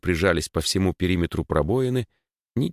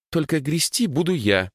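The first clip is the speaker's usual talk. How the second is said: put-on girl declaring; 10 semitones higher than usual. A narrator's reading. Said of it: "заявила Даша".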